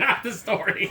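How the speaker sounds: ordinary delivery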